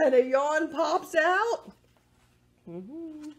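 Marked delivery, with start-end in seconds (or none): 0.0-1.7 bright, lilting storybook voice for little ones; reading to children. A narrator's reading. Librarian reading a yawn book to children, lilting storybook voice. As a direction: read it like storytime, sing-song and higher.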